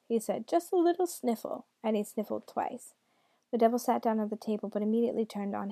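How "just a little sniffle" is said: high, swooping put-on granny; grandmother voice. A narrator's reading. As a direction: reassure in a high granny lilt. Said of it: "in his best grandmother voice".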